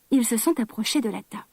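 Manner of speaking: plain reading voice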